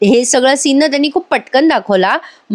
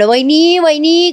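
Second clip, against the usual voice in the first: raised, higher calls of the sister-in-law's name; mock calling at the door; calling through the door; 7 semitones higher, slower than usual. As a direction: call out to her through a shut door, rising. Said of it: "Suman दरवाजा वाजवायला लागते".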